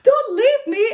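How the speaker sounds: high, loud panicked plea; dramatized desperate shout; retold panic. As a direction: cry out as if being abandoned mid-panic. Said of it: "I started panicking".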